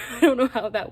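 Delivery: baffled, loud laughing delivery. Real, not acted. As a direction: laugh through the words in disbelief. Baffled laugh at own word count.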